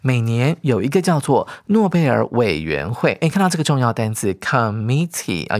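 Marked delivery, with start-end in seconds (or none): none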